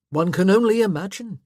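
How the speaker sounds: loud, sarcastic husband. A narrator's reading. Husband doubting his wife's relatives, sarcastic. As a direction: scoff at her hopes loudly.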